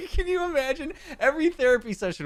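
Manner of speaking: laughing through the words, pitch shooting way up; can't keep straight face. Real, not acted. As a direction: crack up mid-sentence, high and loud. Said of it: "can't even say that with a straight face".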